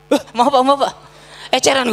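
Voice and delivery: mock-humble sorry to an angry man. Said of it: "gue tanya".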